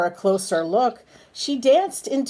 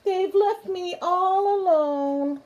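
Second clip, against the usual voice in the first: high, tearful lament; crying; 9 semitones higher than usual. Acted. Miss Spider left alone, high tearful wail. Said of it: "she cried".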